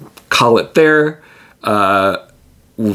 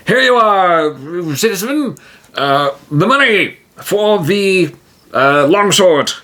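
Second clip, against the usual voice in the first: much higher, pompous heroic voice; a proud hero booming praise; grand hero voice; 9 semitones higher than usual. Acted. T-Jim pays the blacksmith.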